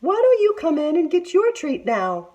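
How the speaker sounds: high, sugary put-on auntie voice; sweet fake auntie. A narrator's reading. Tiger to Big Sister, luring her in sweetly. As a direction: lure her in with false sweetness.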